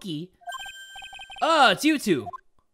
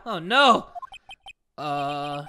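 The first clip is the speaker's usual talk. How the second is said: ordinary talk, nothing marked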